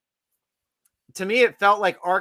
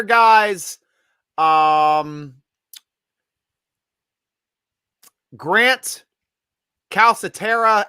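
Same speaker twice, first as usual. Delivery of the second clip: draft announcer; 3 semitones higher, 4 dB louder than usual. Acted. Host announcing draft picks to listeners, broadcaster voice. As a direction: call the picks like the NFL Network.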